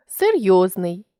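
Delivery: ordinary talk, nothing marked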